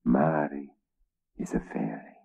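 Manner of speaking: hushed secret whisper; king whispering his wife's secret. Acted. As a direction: breathe it out as a confession. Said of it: "He dropped his voice to a whisper".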